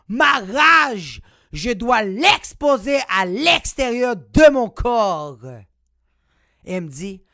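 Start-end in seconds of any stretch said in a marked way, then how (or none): 0.1-5.4 slow, loud mock-furious shout; mock rage. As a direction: shout it slowly, building up the rage. Arguing with grandmother. (cri de rage exagéré et lent)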